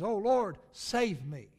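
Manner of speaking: loud plea for rescue; crying out. Retold or imitated. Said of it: "He says".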